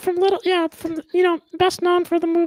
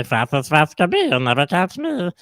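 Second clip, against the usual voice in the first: strained cartoon critter from the throat; tense the throat and taunt like a cartoon jackrabbit; jackalope voice; 12 semitones lower, 4 dB louder than usual. Shown as a demonstration. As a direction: demo of the jackalope voice. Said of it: "you go a little bit like this".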